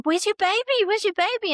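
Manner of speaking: high, loud alarmed urgent question; panicked nurse. Retold or imitated. Mother retelling the nurse's alarm, panicked high call. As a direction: imitate a nurse in a sudden panic.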